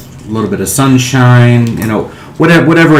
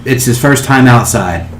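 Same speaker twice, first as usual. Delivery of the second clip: normal talking voice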